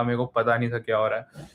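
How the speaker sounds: ordinary talk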